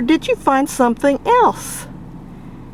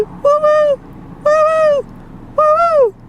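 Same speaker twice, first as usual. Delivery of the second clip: high woo-woo bird call; high, bright call to the bird. Real, not acted. Calling out to the bird.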